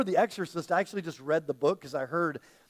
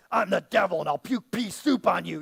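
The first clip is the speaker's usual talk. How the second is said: hammed-up horror movie demon, louder; creepy devil voice; 5 dB louder than usual. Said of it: "give it the creepy voice".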